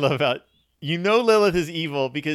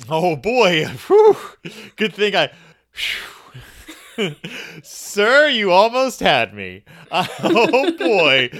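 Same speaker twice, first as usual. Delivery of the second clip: laughing loudly through the words; higher, louder, cracking up; real laughter; 6 semitones higher, 10 dB louder, slower than usual. Real, not acted. Laughing loudly at the co-host's joke ending.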